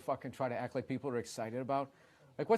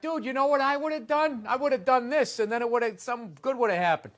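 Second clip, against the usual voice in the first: mock armchair quarterback; 8 semitones higher, 7 dB louder than usual. Mocking armchair quarterback boast.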